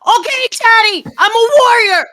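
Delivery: put-on high, loud little-kid shout; crying-out child. Colin answering Daddy as warrior, childish cry. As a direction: shout bravely like a small boy. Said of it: "Colin cries".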